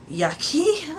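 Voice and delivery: high, loud "yucky"; disgusted yucky. Real, not acted. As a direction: squeal it out in disgust.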